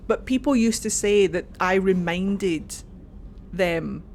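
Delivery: ordinary delivery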